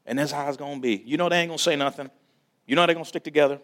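a hint of easy talk among brothers; friends' banter. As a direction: lightly voice a brother who gets it. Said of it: "It was like".

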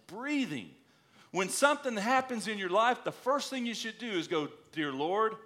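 fervent sermon push; preaching. Acted. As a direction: lift it up and exhort the congregation. Pastor exhorting the congregation.

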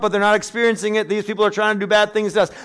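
loud, high, driving sermon voice; full-force preacher; forceful preaching. Acted. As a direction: preach it hard to the congregation.

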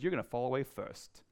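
usual speaking voice

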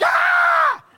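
sudden high shriek of fright; terrified scream. Pretend. Disciple in locked room screams at sudden Jesus. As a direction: shriek like you've seen a ghost. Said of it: "you're gonna be like".

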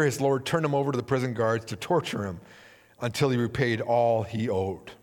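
usual speaking voice

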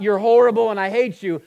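loud, high, contemptuous snap; mock hateful accuser. Satan condemning the believer, mock loud hateful shout. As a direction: spit it out like the accuser would.

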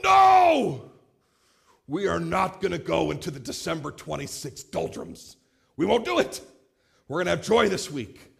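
high, loud shout refusing the gloom; father yelling at the family dinner table to keep the joy going. Retold or imitated. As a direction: bellow it to ban the post-Christmas blues. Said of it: "I yelled at him just like that".

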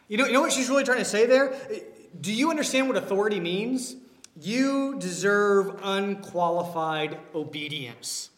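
ordinary talk, nothing marked

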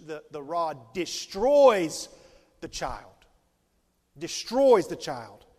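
loud, slow stress on destroys; "destroys" punched loud and slow; emphatic stress. Acted. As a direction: hammer destroys home, slowly. Emphatic corrected translation.

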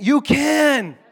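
high, loud retort on you; emphatic shout. Real, not acted. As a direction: shout back YOU can. Preacher rebuts objection.

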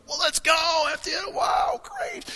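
like a fan who can't wait to meet the band; eager fan. Retold or imitated.

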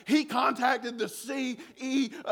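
a whiny accusing mock voice; mock annoyed woman. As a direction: drag it out like an offended clerk. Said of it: "She's like".

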